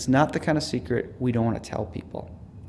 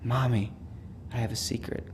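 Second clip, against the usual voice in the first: retold child's stage whisper, dropping softer at the end; 5 dB quieter than usual. Young son turns to his crying mother, mock stage whisper. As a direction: imitate a small boy confiding in a hush. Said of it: "said in a stage whisper".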